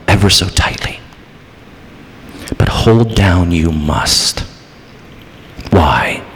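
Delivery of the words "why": ordinary talk, nothing marked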